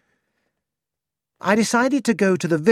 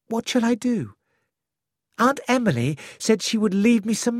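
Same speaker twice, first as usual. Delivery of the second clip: a young woman pleading for advice, voiced higher; higher, anxious young woman's voice; young Cynthia; 6 semitones higher than usual.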